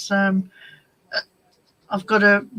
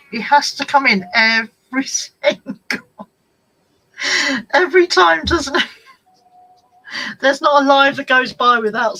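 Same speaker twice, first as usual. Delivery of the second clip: laughing, louder and higher; amused laugh at the cat's interruption; loud laughing exasperation; 6 semitones higher, 8 dB louder than usual. Real, not acted. Cat barges into the live stream.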